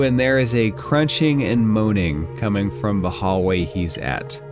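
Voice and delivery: normal talking voice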